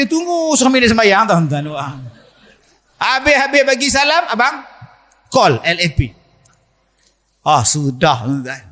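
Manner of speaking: usual speaking voice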